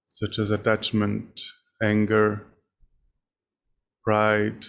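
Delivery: ordinary delivery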